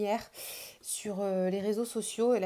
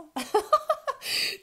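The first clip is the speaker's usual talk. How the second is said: a burst of high, gleeful laughter; delighted laugh; 12 semitones higher, 3 dB louder than usual. Real, not acted. Showing off her favourite card, laughing with delight.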